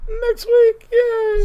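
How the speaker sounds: high, loud cheer; excited cheer. Real, not acted. Co-host echoing the sign-off, excited cheer. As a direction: squeal it with playful glee.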